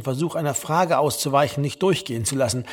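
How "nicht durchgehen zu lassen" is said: usual speaking voice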